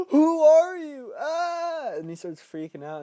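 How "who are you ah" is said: high, loud, slow terrified cry; panicked scream. Acted. Ben realizing she may be the clown, high terrified scream. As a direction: recoil in horror.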